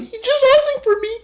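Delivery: very high falsetto caricature; Allie impression. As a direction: squeak it as a mock innocent woman. Retelling Allie's reply.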